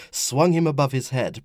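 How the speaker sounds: ordinary delivery, nothing marked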